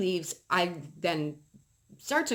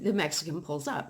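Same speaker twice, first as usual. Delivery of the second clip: usual speaking voice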